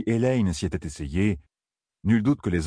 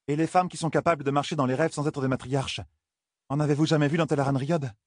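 put-on higher, careful young woman asking; 8 semitones higher than usual. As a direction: ask it warily. Egwene asks matriarchs.

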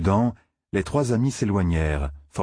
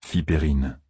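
ordinary delivery, nothing marked

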